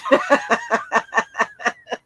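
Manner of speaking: real burst of rapid laughter; laughing. Real, not acted. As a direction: crack up in a long run of chuckles. Laughs at own remark.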